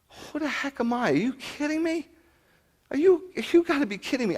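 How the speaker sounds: high, disbelieving voice, hammed up; mock-astonished who-am-I squeal; incredulous disbelief. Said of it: "I'm like".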